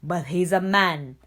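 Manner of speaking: loud, low protest; cry out. A narrator's reading. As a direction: roar back the objection. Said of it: "cries Shere Khan".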